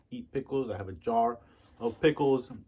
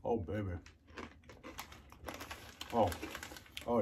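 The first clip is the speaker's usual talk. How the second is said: ordinary talk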